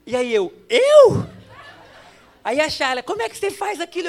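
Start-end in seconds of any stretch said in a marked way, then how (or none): 0.7-1.3 high, loud yelp of surprise; incredulous shock. Retold or imitated. Pastor suddenly named at the wedding, loudly astonished. Startled at being the honoree. 3.0-4.1 quick, high-pitched imitation of a reproachful wife; mock reproach. Wife questioning the pastor afterwards, mock-reproachful.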